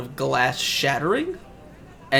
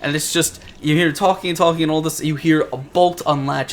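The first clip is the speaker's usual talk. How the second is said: ordinary talk, nothing marked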